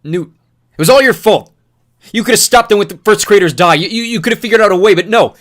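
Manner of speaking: loud, high, relentless tirade; furious yelling. Acted. Newt yelling accusations at Thomas, furious and loud. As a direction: rage at him without letting up. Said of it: "spit flew from his mouth as he yelled".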